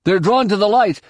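high, urgent realization; shouting. A narrator's reading. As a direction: shout out a sudden discovery. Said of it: "As Jason shouted".

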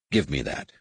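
low, lightly grunted order. A narrator's reading. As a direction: drop the pitch for a brusque demand. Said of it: "Corrin grunted".

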